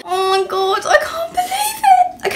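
very high tearful squeal of disbelief; overwhelmed, near tears. Real, not acted. Vlogger reacting to a gift from a brand, overwhelmed squeal. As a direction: squeal it, overcome with joy. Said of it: "I'm actually gonna cry".

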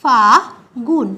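ফাগুন very slowly, split as fa, gun; syllable-by-syllable pronunciation. Shown as a demonstration. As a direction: draw out ফাগুন in two syllables without an l. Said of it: "যেটাকে আমরা উচ্চারণ করছি".

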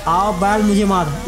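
slow, drawn-out proverb; proverb called out. Acted. Gamer mocking the trouble he invited, drawn-out proverb. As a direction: stretch each word like an invitation to trouble. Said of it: "इसी को कहते हैं".

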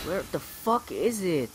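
frustrated outburst. Real, not acted. Frustrated search in game.

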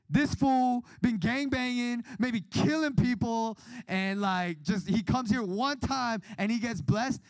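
high, loud, slow indignation; indignant complaint. Retold or imitated. Churchgoer complaining to God about a blessed gangbanger, high loud indignation.

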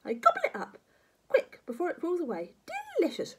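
high, greedy granny relish; gloating grandma. Acted. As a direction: savour it, ending on a hissy slurp.

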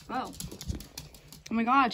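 ordinary talk, nothing marked

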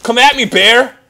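high, loud fighting taunts; taunting challenge. Pretend. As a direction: dare the bear to attack.

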